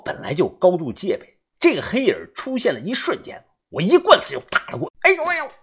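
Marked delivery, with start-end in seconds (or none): 5.0-5.5 sharp high yelp of pain, twice; cry of pain. A narrator's reading. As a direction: yelp out as the stick lands. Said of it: "一声惨叫传来".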